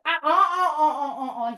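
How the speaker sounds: high, loud, rapid ape chatter; eager little monkey hoots; monkey hooting. Pretend. Monkey wants another banana, excited hooting.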